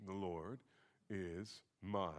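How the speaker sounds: "The Lord is my" held back soft and low, word by word; low, measured lead-up with pauses between words; setting up emphasis. Shown as a demonstration. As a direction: hold "my" back quietly, leaving the next word hanging. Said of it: "Let's emphasize a different word".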